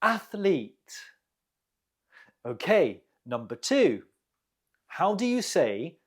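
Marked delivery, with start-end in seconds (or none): 0.0-0.9 "Athlete" with ATH stressed, then falling; first-syllable stress. Shown as a demonstration. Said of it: "The stress is on the first syllable".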